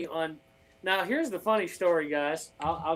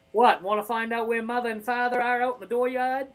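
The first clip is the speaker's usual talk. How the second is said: put-on a light, playful Maine accent, slightly raised pitch; 3 semitones higher than usual. Wife asks him to talk like back home, so he answers in a light Maine accent. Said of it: "talk like from your, you know, you're back in your hometown".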